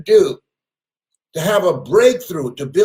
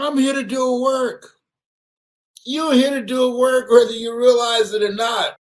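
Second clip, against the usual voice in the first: preaching shout; 6 semitones higher than usual. Real, not acted. Preacher at full force.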